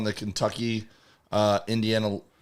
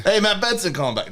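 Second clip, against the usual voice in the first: higher, bright fake caller greeting; 7 semitones higher than usual. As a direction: pretend to be a caller phoning back. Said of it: "No, I'm just kidding".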